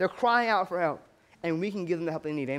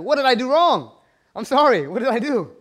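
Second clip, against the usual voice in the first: loud, high-pitched imitation of dismay; panicked apology; 5 semitones higher, 8 dB louder than usual. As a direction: act out a flustered, pleading apology. Worker panics as man cries harder.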